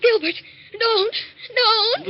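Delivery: high, loud cries of panic; frightened yelling. Acted. Wife waking to her husband standing over her, yelling in fear. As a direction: yell out as if waking in terror. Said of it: "Why were you yelling, "Don't, don't"?".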